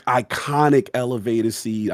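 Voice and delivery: ordinary talk, nothing marked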